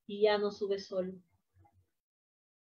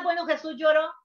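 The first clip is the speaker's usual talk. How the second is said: ordinary delivery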